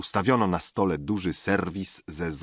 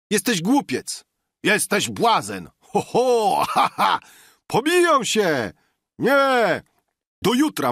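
high, loud exclamations; shouted quarrel; 13 semitones higher, 7 dB louder than usual. A narrator's reading. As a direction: yell it as rival diners in a brawl. Pijacka kłótnia przy stole. (głośna, krzykliwa kłótnia)

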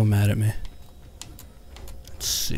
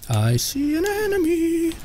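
high, slow, held-out call; playful singsong; slower than usual. Pretend. Gamer spotting an enemy, playful singsong. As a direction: sing it out high and teasing.

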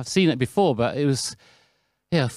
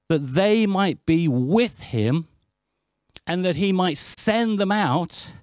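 scripture reading; 3 semitones higher, slower than usual. Acted. Preacher reading a favourite verse to the congregation, slow and weighty. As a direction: read each phrase slowly, pausing between them.

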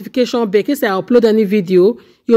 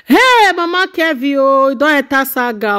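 dismayed exclamation; 4 semitones higher than usual. Real, not acted. Commentator reacting to Mama Kevin entering the saga, amazed wail. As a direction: start high on a long "hey", then slide down.

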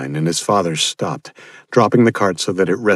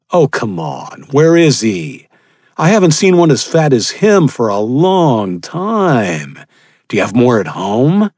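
louder, higher, slow sneering villain; mocking cannibal; 6 semitones higher, 4 dB louder than usual. Cannibal leader taunts the father, sneering and loud. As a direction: toy with your victim.

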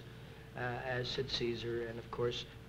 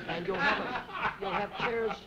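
laughing; 6 semitones higher, 3 dB louder than usual. Real, not acted. Joke punchline, laughs while telling.

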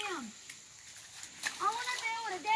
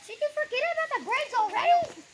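calling out; 6 semitones higher, 8 dB louder than usual. Real, not acted. Scolding child about bridge.

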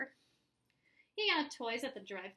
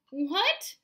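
loud, higher-pitched disbelief; astonished; 5 semitones higher, 7 dB louder than usual. A narrator's reading. Mom nearly falls over, shouting in surprise. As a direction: blurt it out, stunned. Mom stunned by easy agreement.

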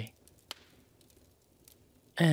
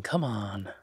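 ordinary talk

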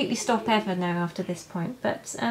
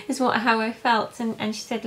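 normal talking voice

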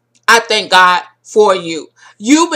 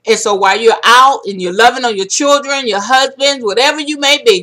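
normal talking voice

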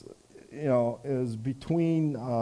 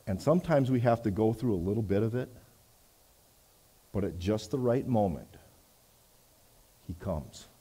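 ordinary talk

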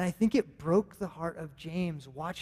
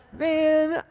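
much higher, louder drawn-out "Man"; exasperated groan; 10 semitones higher, 6 dB louder than usual. Retold or imitated. Pastor answering his son's teasing, exasperated. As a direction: let it out like you've had enough.

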